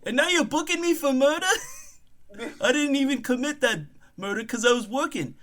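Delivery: high-pitched, protesting comic voice; indignant accused bookkeeper. Acted. Murder suspect insisting on an innocent job, high indignant protest.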